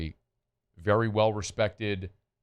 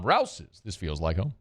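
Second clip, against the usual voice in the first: ad slogan; 3 semitones lower, slower than usual. Acted. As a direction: sing out the brand, then land the tagline gently. Sponsor slogan tagline.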